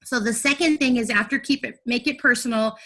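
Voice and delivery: usual speaking voice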